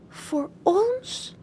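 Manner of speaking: soft, careful little question; cautious. Acted. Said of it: "vraagt hij voorzichtig".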